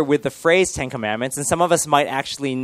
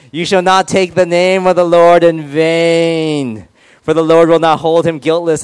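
slow, raised booming commandment voice; grand proclamation; 3 semitones higher, 5 dB louder, slower than usual. Acted. Pastor proclaims the third commandment to the room, grand proclamation. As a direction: proclaim it high and loud, stretched out.

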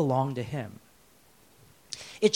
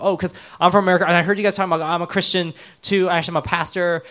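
loud, hurried, higher-pitched explanation; flustered self-justifying; 4 semitones higher, 7 dB louder, faster than usual. Retold or imitated. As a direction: blurt out excuses to strangers, retold. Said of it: "I'm trying to justify myself".

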